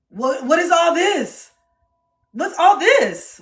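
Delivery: high, loud, slow bewilderment; baffled partner. Retold or imitated. Playing the confused partner hearing about dad. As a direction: drag out the confusion.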